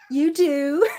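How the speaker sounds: loud, high, delighted to the cat. Real, not acted. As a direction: squeal it to your pet. Talking to the cat.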